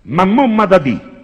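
loud, high, slow Roman "Mamma Madadi"; Roman dialect. Shown as a demonstration. Comedian shows the Roman version, loud slow Roman. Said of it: "in romano è".

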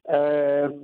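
low, hoarse voice from the flu. Real, not acted. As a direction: voice worn down by illness. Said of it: "c'ho la voce un po' così, eh, purtroppo l'influenza".

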